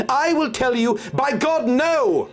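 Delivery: fierce outburst. Acted. Sybil swearing to tell the doctor, fierce. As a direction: swear it at him with fury. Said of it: "she cried, almost savagely".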